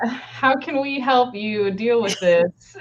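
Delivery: high, slow fake-sweet service voice; overly polite helper voice; forced helpful librarian to upset patrons; performative customer service. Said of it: "had to go in there and be like".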